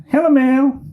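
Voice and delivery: bright high flirty caller voice; sweet-talking ex calling her; ex on the phone. Acted. Ex calls Melissa on phone. (mantan yang menelepon dengan suara tinggi menggoda)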